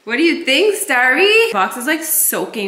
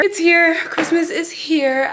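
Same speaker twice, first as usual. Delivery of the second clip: excited; 7 semitones higher, slower than usual. Real, not acted. Tree finally arrived, thrilled.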